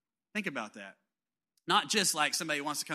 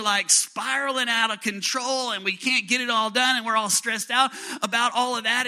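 loud, high, driving preaching; full-force preaching; 6 semitones higher, 7 dB louder than usual. Acted. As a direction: build the sermon to a peak, loud.

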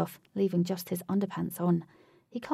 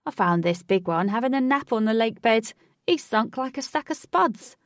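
loud, raised character voice; boisterous rescuer; 4 semitones higher, 7 dB louder than usual. A narrator's reading. Huey to the crowd with rescued Matt, boisterous. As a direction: crow about the rescue, loud and high.

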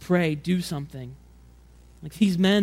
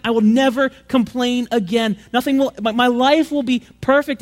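higher, louder, rushing hope; desperate plea; 5 semitones higher, 5 dB louder than usual. Retold or imitated. Crippled man begging Jesus to fix his legs, pleading. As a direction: beg for your legs to be fixed.

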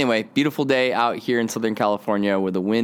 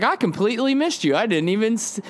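high, sheepish stranger's voice; stranger apologizing; 10 semitones higher than usual. Retold or imitated. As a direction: play the stranger owning up, much higher.

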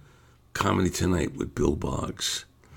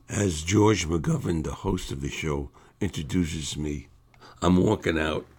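ordinary delivery

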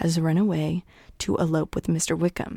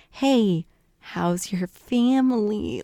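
slow, pointed fishing question; Bingley; 2 semitones higher, 3 dB louder, much slower than usual. Retold or imitated. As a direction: draw out "How's your family" to pry for news. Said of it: "trying to get out information".

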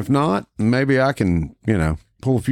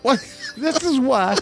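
laughing. Real, not acted. Laughing protest at the voice.

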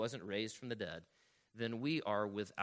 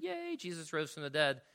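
mock cheer; 5 semitones higher than usual. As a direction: parody a happy Easter cheer. Mocks cheery Easter celebration.

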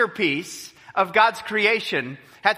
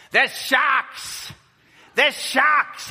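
higher, a hint of a local warning of sharks; lightly voiced Aussie local; 6 semitones higher, much slower than usual. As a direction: mildly play the local warning them.

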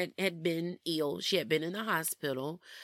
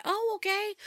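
much higher, bright little "oh, okay"; pleasantly surprised. Retold or imitated. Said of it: "And I'm like".

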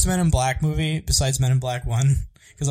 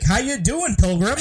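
high, hammed-up cowboy American accent; bad American accent; 9 semitones higher, 4 dB louder, slower than usual. Actor attempting American to the pilgrims, hammed-up bad accent. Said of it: "He's like".

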